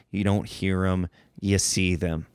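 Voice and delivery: usual speaking voice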